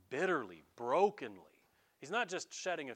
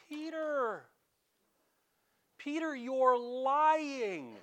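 high, slow, pointed accusation; accusing servant girl; 10 semitones higher, 6 dB louder, much slower than usual. Acted. Servant girl accusing Peter by the fire, high and slow. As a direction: call him out as a liar, drawn out.

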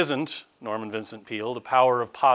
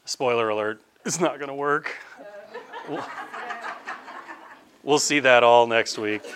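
ordinary talk, nothing marked

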